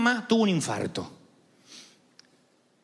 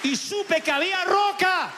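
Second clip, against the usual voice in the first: high, slow proclamation of faith; soaring declaration; 11 semitones higher than usual. Real, not acted. Preacher tells congregation he found rock, soaring high. Climax of testimony.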